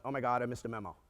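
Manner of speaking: lightly voiced low, soft aside; Tom Selleck inner voice. Worried banker's inner voice on hearing news, hint of low impression. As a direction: think it privately, pitched a little down. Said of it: "my little Tom Selleck voice went".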